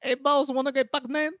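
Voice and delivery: high, hammed-up Filipino guy voice; mock Filipino character, high-pitched; Filipino accent. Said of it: "have I ever done the Filipino voice".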